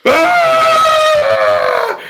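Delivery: high, loud mock war yell; retold battle cry. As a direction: belt out a hammed-up charging scream. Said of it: "and he's going".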